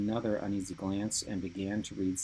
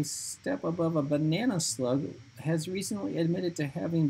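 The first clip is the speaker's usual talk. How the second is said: put-on high, nervous boy reading aloud; high shaky voice; 6 semitones higher than usual. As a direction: read it high and scared. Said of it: "reading in his high, shaky voice".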